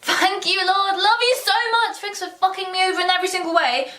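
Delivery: high-pitched, gushing mock thanks; over-sweet, hammed-up praise; sarcastic gratitude. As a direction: thank God with heavy sarcasm. Mock thanks to God for stroke.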